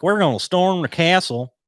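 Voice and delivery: put-on loud, high Southern yokel accent. Mocking rioters in a loud high Southern accent. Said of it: "why I'm doing a Southern accent".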